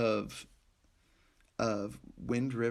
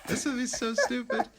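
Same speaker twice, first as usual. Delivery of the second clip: scoffing; 11 semitones higher than usual. Retold or imitated. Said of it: "and I'm like".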